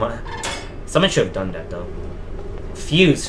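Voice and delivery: normal talking voice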